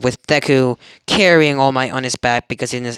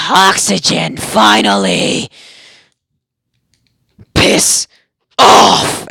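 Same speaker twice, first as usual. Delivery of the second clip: loud, forceful outburst; explosive shout; 3 semitones higher, 5 dB louder, much slower than usual. Acted. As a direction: blast it out after gasping for air. Voices Bakugo freed and erupting.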